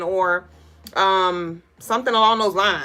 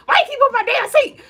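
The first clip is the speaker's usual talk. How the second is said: high, fast laughing diva squeal; Rasputia impression; 5 dB louder, faster than usual. Streamer repeating Rasputia's seat line through laughter. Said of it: "one of my favorite scenes".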